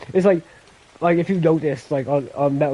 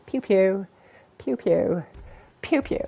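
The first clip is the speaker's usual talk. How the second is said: mock gun noises; 6 semitones higher, 3 dB quieter, slower than usual. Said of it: "you're on the block going".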